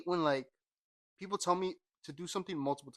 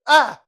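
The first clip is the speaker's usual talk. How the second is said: short, very loud high yell; abrupt attention-grabbing shout; scream; 10 semitones higher, 18 dB louder than usual. Acted. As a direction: blurt a scream and cut it. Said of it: "Oh, you're so loud".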